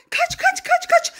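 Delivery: high-pitched, urgent run-away call; urgent warning. Acted. Mother warning Elif before dad wakes, urgent and high. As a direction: urge her to flee, pitch jumping up. Mother urges child to flee.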